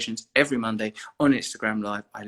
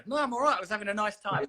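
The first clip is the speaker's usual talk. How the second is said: high, mock-innocent protest, much higher; squeaky mock denial, much higher; 8 semitones higher, faster than usual. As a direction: play the cornered friend insisting he is fine, pitched way up. Voicing Kev's protest.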